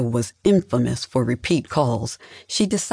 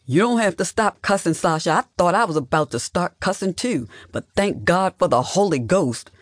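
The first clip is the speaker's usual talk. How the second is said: high, mildly raised call; understated yell, pitched up and amused; yelling across room; 6 semitones higher than usual. A narrator's reading. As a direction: call over to the other room. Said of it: "Russell yelled from across the room".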